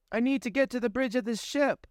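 high, earnest request; young woman's voice. Acted. Pandora tells the ship AI her goal, high and earnest. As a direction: ask the way firmly.

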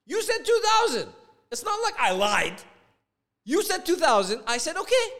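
loud, high indignant yelling; angry shouting. Retold or imitated. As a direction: hurl it back as an accusation. Re-enacts angry quarrel over price.

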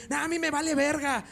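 high-pitched imitation of an angry partner; angry girlfriend. Girlfriend's angry reply retold.

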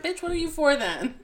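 sassy. Retold or imitated. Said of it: "I'm like".

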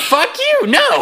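high, loud mock outrage; high-pitched comic refusal; indignant mock retort. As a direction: snap back in hammed-up indignation. Acted indignant comeback to the coach.

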